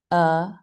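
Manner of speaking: "uh" as a relaxed, neutral vowel; schwa sound. Shown as a demonstration. Teacher showing the second sound of A, schwa sound. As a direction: say "uh" from the throat with a loose jaw. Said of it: "they make a big difference".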